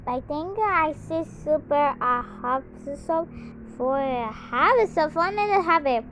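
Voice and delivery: normal talking voice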